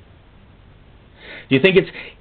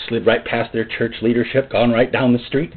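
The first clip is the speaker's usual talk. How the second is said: ordinary talk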